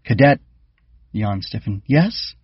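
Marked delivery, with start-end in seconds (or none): 0.0-0.4 loud, high barked call; sharp call. A narrator's reading. 1.9-2.4 high, startled question. A narrator's reading. Startled cadet answers.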